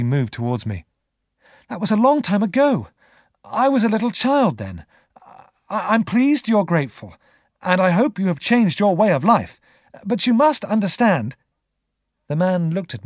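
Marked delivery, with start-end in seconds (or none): 1.7-10.3 high, insistent rebuff; firm young Pip. A narrator's reading. Pip keeps the grateful convict at a distance, firm. 10.3-11.4 high, emphatic "understand"; insistent young Pip. A narrator's reading. Pip presses the convict to accept distance, insistent.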